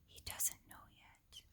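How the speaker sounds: hushed whisper of a secret. Acted. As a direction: breathe it so the child can't hear. Secret kept from child.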